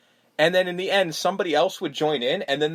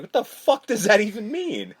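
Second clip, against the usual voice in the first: incredulous what-the-fuck; mock outrage at a baffling line; baffled; 7 semitones higher than usual. Baffled at a nonsense movie line. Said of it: "I'm like".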